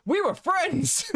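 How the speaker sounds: high, hammed-up wounded whine; mock betrayed outrage. Streamer mock-pleading with Chud, high hammed-up hurt. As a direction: play the jilted friend, overdone.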